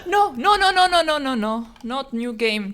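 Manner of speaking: loud, high panicked no's; panicked denial. Real, not acted. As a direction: beg it not to be true. Fearing lost save.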